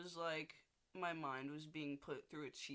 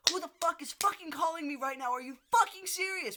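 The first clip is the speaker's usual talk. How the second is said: yelling, much higher and louder, fast; furious shout; 12 semitones higher, 12 dB louder than usual. Retold or imitated. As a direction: blow up at the ringing phone. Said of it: "I say".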